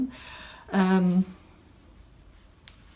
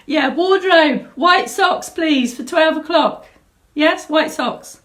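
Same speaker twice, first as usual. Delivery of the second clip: hammed-up shout to the wardrobe department; playful pretend order shouted offstage; mock call to wardrobe; 7 semitones higher, 5 dB louder, slower than usual.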